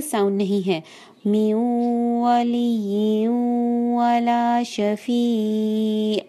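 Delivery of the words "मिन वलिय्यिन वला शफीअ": slow and even, मिन वलिय्यिन वला शफीअ; natural recitation. Shown as a demonstration. Teacher models the natural recitation. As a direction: read it in a natural flow. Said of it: "बिल्कुल natural अंदाज में पढ़िए".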